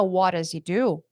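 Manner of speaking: What does he do? linked, a bit slow; connected speech. Shown as a demonstration. Teacher showing the linked result. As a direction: blend What does he do? together. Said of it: "Isso vira".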